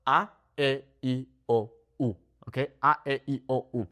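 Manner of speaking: short, clipped A, E, I, O, U; crisp vowel drill; abrupt Spanish vowels. Shown as a demonstration. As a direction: cut each vowel off sharply. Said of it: "It's abrupt".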